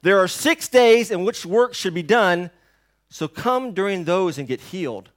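the synagogue ruler angrily scolding the crowd; indignant yell. Retold or imitated. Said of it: "He yells out to the crowd".

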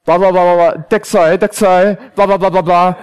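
insistent babble, mimicking the guard; mock urgent jabbering; imitating urgent gibberish. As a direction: rattle out urgent foreign-sounding blahs. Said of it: "from the way that she's talking that it's very important".

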